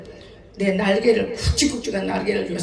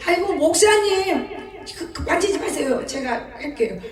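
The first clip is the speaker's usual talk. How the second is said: pleading congregant; 6 semitones higher than usual. Retold or imitated. Church woman begging the pastor, high pleading. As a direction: beg the pastor to stop, voice pitched high.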